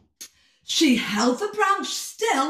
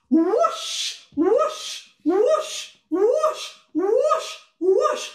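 high swooping whooshes; wind whooshing; 10 semitones higher, much slower than usual. Acted. Storyteller imitating the wind, high rising whooshes.

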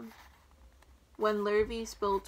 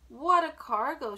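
drawn-out, louder exclamation; exclaiming; 4 semitones higher, 7 dB louder, slower than usual. A narrator's reading. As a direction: marvel at it slowly, pitched up. Sheep exclaims at the crate's load.

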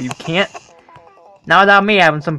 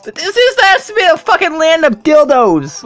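way higher, loud disbelief; incredulous high yell; 11 semitones higher, 4 dB louder than usual. Real, not acted. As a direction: shout it in amazed outrage. Shocked exclamation at visuals.

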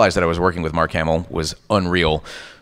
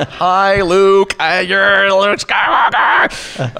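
high, loud, drawn-out fan squeal; hammed-up squeal that stretches into a shout; starstruck fan; 11 semitones higher, 5 dB louder, much slower than usual. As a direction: lose it completely, mock fanboy meltdown. Acting out starstruck fan greeting Hamill.

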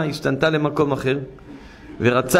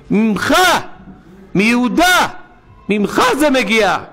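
high, louder preaching; thundering sermon emphasis; 7 semitones higher, 4 dB louder than usual. Real, not acted. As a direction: hammer home the accusation.

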